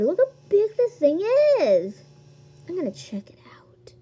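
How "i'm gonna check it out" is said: hushed, voiceless whisper. Real, not acted. Player to herself, going to check it out, whispering. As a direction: whisper it under the breath.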